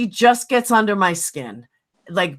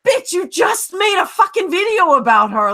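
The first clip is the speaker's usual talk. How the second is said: much higher indignant outburst; exasperated retort; 8 semitones higher than usual. Real, not acted. Ranting to her friend about a rival, indignant outburst. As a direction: throw it back at her in disbelief.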